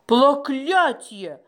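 slow dismayed exclamation; exclaiming. A narrator's reading. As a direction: curse it out in dismay. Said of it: "воскликнул Маляк".

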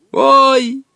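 put-on high, loud boy's cry; boy cries out as the spell begins; ringing boyish voice. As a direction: yelp like a young boy. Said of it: "звонким мальчишеским голосом".

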